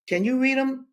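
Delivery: high-pitched rising question; incredulous question. A narrator's reading. Captain asking Linda about her verses, high questioning. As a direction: lift it right up as a question. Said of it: "he asked".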